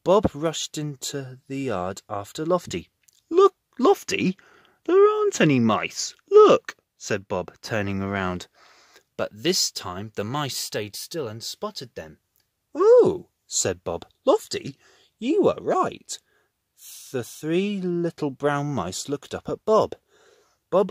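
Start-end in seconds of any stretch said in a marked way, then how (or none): 3.3-6.8 high, loud insistence; insisting. Acted. Bob reassuring Lofty. 12.7-13.3 very high, loud surprise; surprised oh. Acted. Bob sees the mice. 14.2-16.2 surprised. Acted. Bob realises.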